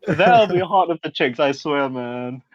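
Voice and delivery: usual speaking voice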